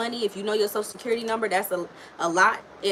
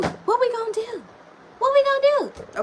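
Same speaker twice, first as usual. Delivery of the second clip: high squeaky exasperated "What we gonna do?"; mock panic; 3 dB louder than usual. Reader mocking the mom's stalling, high squeal. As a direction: squeal it in comic despair.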